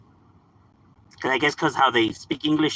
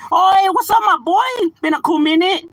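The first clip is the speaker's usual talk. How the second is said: high, loud, happy hello; big excited hey to a returning buddy; excited greeting; 6 dB louder than usual. Real, not acted. Greeting a friend on stream.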